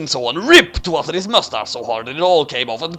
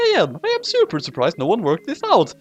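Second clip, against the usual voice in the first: plain reading voice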